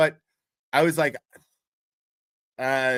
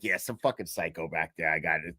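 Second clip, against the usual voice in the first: much lower, offhand and dismissive; a lawyer shrugging about the psycho in back, much lower; gruff lawyer voice; 10 semitones lower, 6 dB quieter than usual. Retold or imitated. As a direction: drop low like a careless tough lawyer. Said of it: "he was like".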